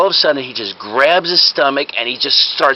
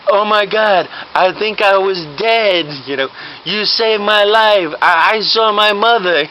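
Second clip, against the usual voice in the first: higher, slow, dazed wonder; awed revived patient; 6 semitones higher, slower than usual. Acted. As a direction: marvel slowly at being saved. Revived man marvels he lived.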